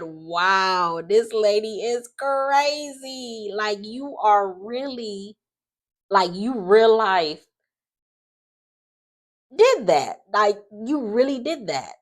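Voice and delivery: slow, high stunned disbelief. Real, not acted. Vlogger reacting to Leslie's confession, slow and stunned. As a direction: drag each word out in shock. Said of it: "I said".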